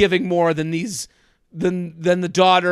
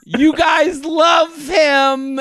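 excited shout; 6 semitones higher, much slower than usual. Retold or imitated. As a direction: hype it up like an overeager grandma. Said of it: "and she goes".

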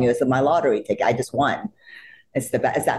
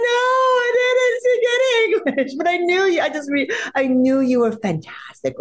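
teary plea. Retold or imitated. Fan asking her idol for a photo, high and tearful. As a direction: beg for a photo through tears.

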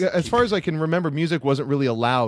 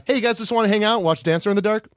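party bummer voice; 7 semitones higher than usual. Pretend. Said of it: "my impression of, uh, the human embodiment of a party bummer".

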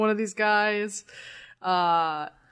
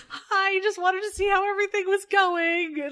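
much higher, breezy mock-cheerful voice; chirpy teacher; 9 semitones higher than usual. Teacher checking in mid-fight, high oblivious cheer. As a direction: pop in all sweet and oblivious. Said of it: "she's like".

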